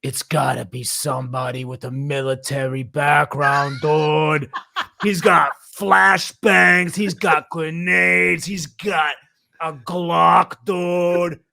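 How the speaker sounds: loud, slow Boston know-it-all; theater guy calling out every scene, thick Boston accent. Retold or imitated. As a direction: call out the plot like a loud Boston moviegoer. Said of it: "He's like".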